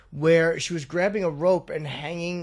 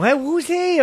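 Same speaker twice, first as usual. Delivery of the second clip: high, loud imitation of a child; curious kid; 12 semitones higher, 6 dB louder, slower than usual. Kid asking a parent about a stranger, childlike curiosity. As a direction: pipe up like a nosy little kid. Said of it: "'cause the kid might go".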